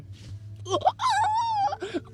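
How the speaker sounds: hammed-up very high squeal. Girl reacting to the sword, very high squeal. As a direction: shriek like a cartoon.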